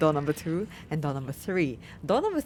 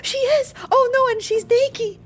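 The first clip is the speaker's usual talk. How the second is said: high, loud squeal of shock; real overexcited shriek; freaking out; 7 dB louder than usual. Real, not acted. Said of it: "I'm freaking out".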